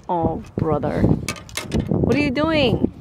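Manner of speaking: ordinary talk, nothing marked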